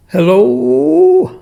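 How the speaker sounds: loud, drawn-out hello rising high; calling out hello. Real, not acted. Speaker testing whether the recording is too loud, calling out loudly. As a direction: call out a long questioning hello to test the sound.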